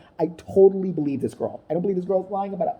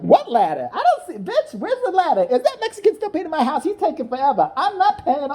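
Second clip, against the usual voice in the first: high, hammed-up Oprah voice; high mock Oprah; 10 semitones higher than usual. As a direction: squeal it as a clueless diva. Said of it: "And Oprah's like".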